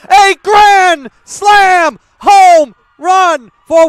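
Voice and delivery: rising, shouting play-by-play call; home run call. Acted.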